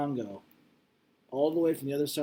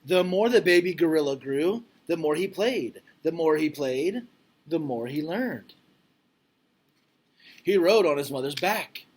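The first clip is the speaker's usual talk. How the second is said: louder, expressive read-aloud voice; reading aloud to class; 4 semitones higher, 7 dB louder than usual. Acted. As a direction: read it out like a storybook for kids. Reads picture book to class.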